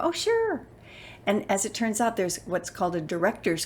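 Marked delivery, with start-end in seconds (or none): 0.0-0.7 very high, imitated cheerful consent; high-pitched mock welcome; breezy agreement. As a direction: sing it out high, happy to oblige. Studio people cheerfully agree.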